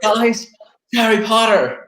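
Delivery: very high mock excitement; hammed-up, high-pitched amazement; imitating thrilled teammates. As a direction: call the others over in a put-on high voice.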